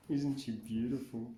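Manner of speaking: usual speaking voice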